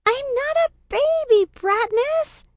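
put-on very high, loud child squeal; high squealing little-girl outburst; little sister crying out. A narrator's reading. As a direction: protest shrilly like an indignant kid sister. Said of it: "she cries".

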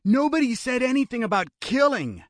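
high-pitched objection; alarmed protest. A narrator's reading. Kidnapper objecting to his brother's murder plan, alarmed protest. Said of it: "Barry protested".

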